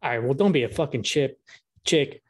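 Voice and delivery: ordinary delivery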